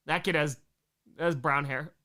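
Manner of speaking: ordinary talk, nothing marked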